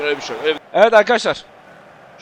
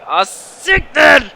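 louder, higher groan at the miss; frustrated near-miss cry; 7 semitones higher, 6 dB louder, much slower than usual. Real, not acted. Gamer reacting to a missed chance, frustrated cry. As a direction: let the miss hurt out loud.

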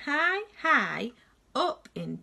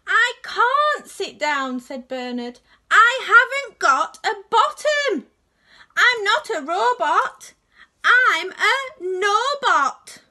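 much louder, higher wailing complaint; upset protest; 10 semitones higher, 10 dB louder than usual. Acted. Bernard refusing his friends, loud upset wail. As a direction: cry it out, stretching nobot.

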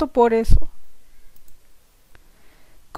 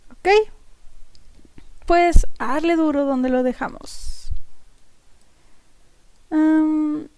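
usual speaking voice

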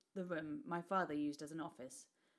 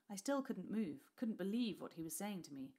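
ordinary delivery

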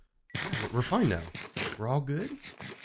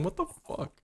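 normal talking voice

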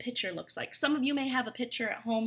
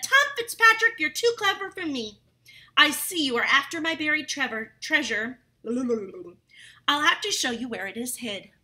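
leprechaun giving in; 3 semitones higher, 6 dB louder than usual. Acted. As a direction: play the caught fairy man, exasperated. Leprechaun gives in, defeated.

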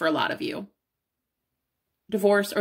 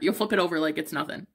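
ordinary delivery

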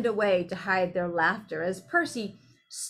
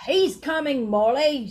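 louder, raised character voice; husband's loud answering call; calling back; 5 semitones higher, 7 dB louder than usual. Acted. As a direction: call back to your wife. Husband calls back to his wife.

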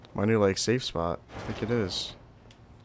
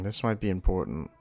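normal talking voice

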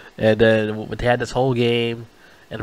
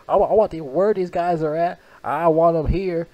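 talking tough; 6 semitones higher than usual. Retold or imitated. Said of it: "Denzel Washington was talking shit".

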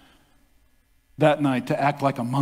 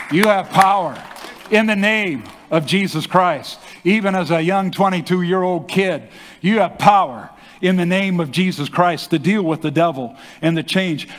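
full-force preaching; 4 semitones higher, 3 dB louder than usual. Acted. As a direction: proclaim it to the whole church. Preaching climax to congregation.

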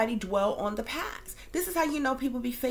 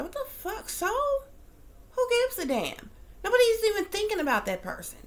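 incredulous outburst; 10 semitones higher than usual. Real, not acted. Outraged reaction to quoted post.

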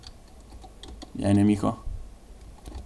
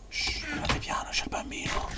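whispering; 15 dB quieter than usual. Pretend. Hushing others, baby nearby.

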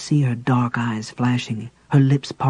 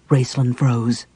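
plain reading voice

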